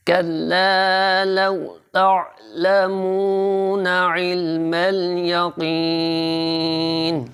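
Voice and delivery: slow melodic tajweed recitation; slow melodic Quran recitation. Shown as a demonstration. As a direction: chant it slowly, each syllable drawn out. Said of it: "Roman میں بھی اسی طرح".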